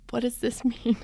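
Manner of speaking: confused whisper through a laugh; whispered question. Retold or imitated. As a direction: hush it like asking a secret. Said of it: "I'm like".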